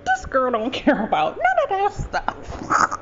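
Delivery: high, drawn-out amused realization; liberated, happy mother. Retold or imitated. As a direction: imitate a mother's delighted discovery. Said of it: "She was liberated".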